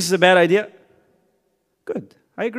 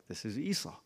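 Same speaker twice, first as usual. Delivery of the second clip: lightly voiced old father, softer; hushed; 16 dB quieter than usual. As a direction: murmur it almost to yourself. Blind father fooled in story.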